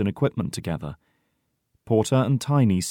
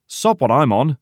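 loud, higher-pitched refusal; stubborn; 5 semitones higher, 6 dB louder than usual. A narrator's reading. Porter defies the old man, stubborn. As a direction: dig in against the order. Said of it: "says Porter stubbornly".

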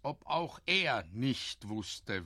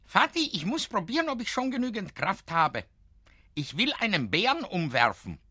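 put-on high, quick child's voice; a small boy bursting to prove himself; eager little boy; 9 semitones higher, 6 dB louder, faster than usual. A narrator's reading. Boy asks father, eager.

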